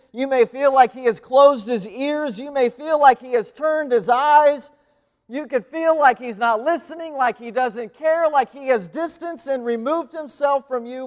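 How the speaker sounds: building sermon, pitch and volume climbing; impassioned preaching. Acted. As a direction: preach it up to a peak of conviction. Preaching build at full force.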